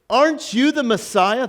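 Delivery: high-pitched, pointed challenge; challenging criminal. Acted. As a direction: put it as a goading question. Acts the taunting criminal.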